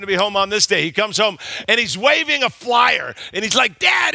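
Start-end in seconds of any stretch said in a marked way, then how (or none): 3.8-4.1 high eager shout of Dad; excited little boy. Retold or imitated. Son calling to his dad from across the room, high excited shout. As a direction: call out like an eager seven-year-old.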